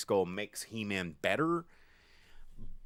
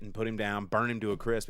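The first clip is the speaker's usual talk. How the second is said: ordinary talk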